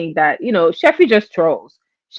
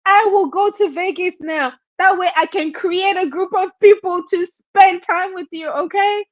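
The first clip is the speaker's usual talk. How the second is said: Jay Wilson beckoning; 9 semitones higher than usual. Retold or imitated. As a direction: lure her in with syrupy calls. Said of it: "Jay Wilson says".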